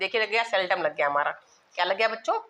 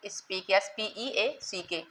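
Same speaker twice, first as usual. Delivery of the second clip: slow, letter-by-letter spelling; dictating the spelling of speak; 6 dB quieter, much slower than usual. Shown as a demonstration. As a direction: spell the word slowly for copying. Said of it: "speak the truth".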